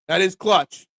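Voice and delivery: ordinary talk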